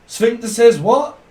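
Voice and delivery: mock demand. Playful call-and-response joke.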